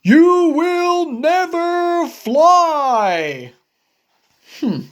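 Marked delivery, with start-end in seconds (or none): 0.0-3.5 higher, louder and slow; slow firm verdict. Acted. Gerald telling Piggy she will never fly: slow and loud. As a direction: drag out each word as a final verdict.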